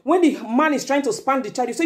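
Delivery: usual speaking voice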